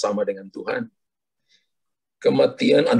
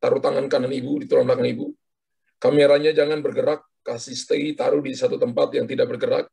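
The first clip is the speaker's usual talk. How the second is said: ordinary talk, nothing marked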